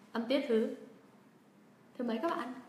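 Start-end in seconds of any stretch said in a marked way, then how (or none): none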